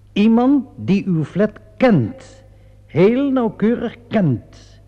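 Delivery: deliberate, pressing accusation; emphatic insistence. Acted. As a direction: spell it out slowly to corner him. Inspector presses home his accusation.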